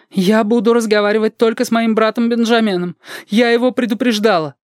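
high-pitched voice of a stubborn witness; acted woman's voice, pitched well up; insistent woman character. Teresa's insistence, voiced high in fiction.

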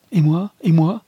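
a sudden high, loud cry of protest; cried out. Acted. Wife cries out to her husband, high and loud. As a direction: burst out, pleading to be counted. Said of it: "s'écria-t-elle".

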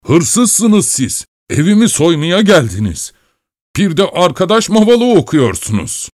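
high, heated outburst; angry accusation. A narrator's reading. Old man accusing the thief, high and angry. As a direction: accuse with pitch way up. Furious husband accuses intruder.

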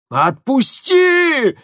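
высокий протяжный крик от боли; молит, срываясь на вопль; испуганный крик. Acted. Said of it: "испуганно закричал Тамир". (испуганно кричит)

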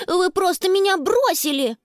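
put-on high, accusing little-boy voice; indignant child. A narrator's reading. Little brother abandoned by the girls, indignant. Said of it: "яростно застучал кулачками".

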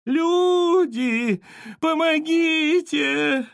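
very high, drawn-out cry for help; thin wailing. A narrator's reading. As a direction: wail loudly, stretching each word. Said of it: "заголосила Вера Семёновна".

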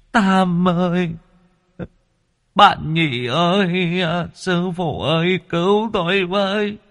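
high, drawn-out plaintive cry for help; feeble wailing. A narrator's reading. Trapped soul calling his friends and master to save him, feeble wail. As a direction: call out each name in a long, high, helpless wail. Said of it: "Lão thều thào".

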